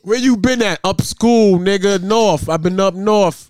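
loud court banter. Pretend.

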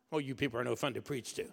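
normal talking voice

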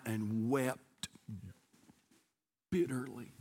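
slow, low and fading to very soft; grave, quiet scripture reading; hushed, sorrowful reading. A narrator's reading. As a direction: let the words sink almost to nothing. Reading of Peter weeping.

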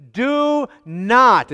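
"Do not" loud and high, each word drawn out; emphatic DO NOT. Acted. Preacher quoting Jesus to the congregation, emphatic "Do not". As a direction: come down hard on both words of "Do not". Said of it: "it's an emphatic".

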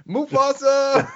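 high drawn-out dramatic cry; Scar yelling Mufasa. Retold or imitated. Roach rushes, Lion King reference.

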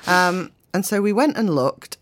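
ordinary talk, nothing marked

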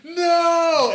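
loud mock yell of denial; shout. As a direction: yell it like a sore loser. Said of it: "immediately went".

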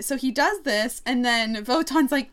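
usual speaking voice

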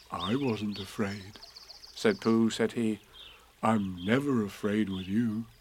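soft, slow little bear voice; shy admission, almost hushed; timid Pooh speaking softly; quiet Pooh. Acted. Pooh quietly admits fear.

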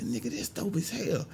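high, soft voice of private excitement; hushed inner thought. Retold or imitated. Comedian thinking to himself on seeing a star, hushed inner thought. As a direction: keep the thrill under your breath. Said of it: "I'm thinking in my head".

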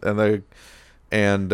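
normal talking voice

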